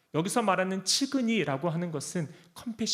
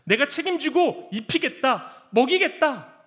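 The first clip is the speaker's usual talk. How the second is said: firm promise; 8 semitones higher, 6 dB louder than usual. Acted. Voicing God's promise.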